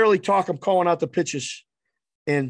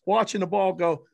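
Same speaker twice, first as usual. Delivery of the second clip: ordinary talk, nothing marked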